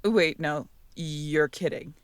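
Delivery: ordinary delivery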